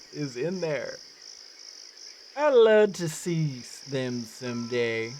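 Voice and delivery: lightly attempted Newfoundland accent; game character's mild Newfoundland lilt. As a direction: greet a visitor with a touch of Newfie brogue. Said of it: "the accent that I should be going for".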